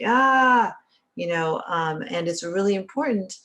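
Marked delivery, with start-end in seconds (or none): 0.0-0.8 mock yell at kids. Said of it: "We're like".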